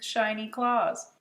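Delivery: ordinary delivery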